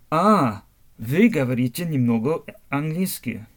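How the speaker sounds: ordinary talk, nothing marked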